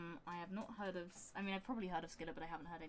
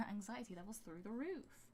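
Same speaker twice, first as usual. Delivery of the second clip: usual speaking voice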